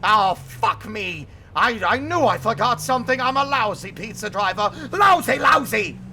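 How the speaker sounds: hammed-up monster despair; monster wail. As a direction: moan like a sorry giant. Monster self-pity in story.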